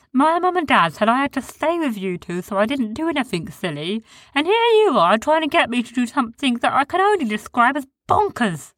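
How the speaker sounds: Sniz's high voice. A narrator's reading. Sniz voiced in story.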